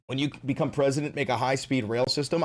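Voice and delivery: ordinary delivery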